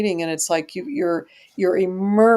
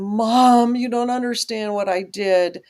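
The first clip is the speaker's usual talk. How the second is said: pleading; 3 semitones higher than usual. Retold or imitated. Retelling his phone call to mom and dad, pleading. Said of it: "pleading and saying".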